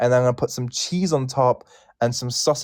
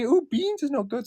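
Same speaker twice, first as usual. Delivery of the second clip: high, soft mock disgust; mocking quote; 11 semitones higher, 4 dB quieter than usual. Host mocking people who dismiss beans, mock disgust. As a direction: mimic the doubters turning up their nose. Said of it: "they always say".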